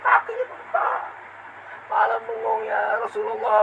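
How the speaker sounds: high, ringing sermon delivery; impassioned preaching. Acted. Preacher recounting the Prophet's devotion, high and fervent. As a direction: raise the voice in fervent exhortation.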